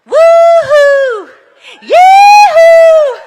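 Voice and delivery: high, loud whooping ad-lib yell. Retold or imitated. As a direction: belt out a carefree woo-hoo like a rapper in the booth. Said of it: "and he goes".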